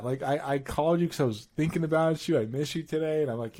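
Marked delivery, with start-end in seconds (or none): none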